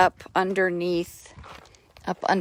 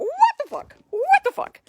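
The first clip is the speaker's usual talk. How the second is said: much higher squawky parrot imitation. Retelling a parrot video to viewers, high parrot squawk. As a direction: mimic a talking parrot. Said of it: "these parrots are going".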